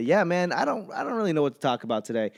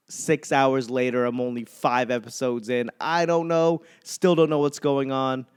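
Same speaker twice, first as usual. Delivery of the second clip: usual speaking voice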